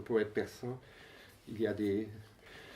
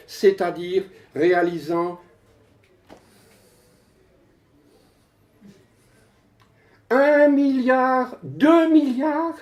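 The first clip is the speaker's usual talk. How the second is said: emphatic recitation climbing high in pitch; poem declamation; 9 semitones higher, 10 dB louder, slower than usual. Acted. Poet announces the first exaflop computer, declaimed. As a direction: proclaim the figures as they mount.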